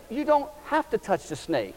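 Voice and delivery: high, loud, insistent urging; exasperated pleading. Retold or imitated. Father urging his scared daughter, high and insistent. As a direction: press her to just do it. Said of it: "I said".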